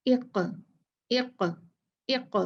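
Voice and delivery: इक़ with the qaf stopped and bounced in a small echo; qalqalah echo. Shown as a demonstration. Teacher showing students an echoing qaf on इक़. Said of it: "ऐसे पढ़ेंगे".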